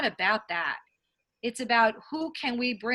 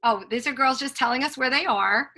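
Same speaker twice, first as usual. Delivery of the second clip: normal talking voice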